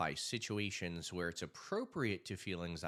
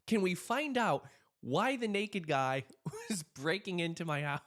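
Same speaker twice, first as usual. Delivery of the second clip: laughing question; 10 semitones higher, 5 dB louder than usual. Retold or imitated. Laughing while asking cop.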